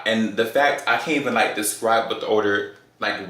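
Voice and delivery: usual speaking voice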